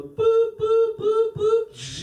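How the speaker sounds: high mock beeps; beeping gadget noise. Imitating the shower gadget beeping at his friend. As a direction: chirp it like a little machine.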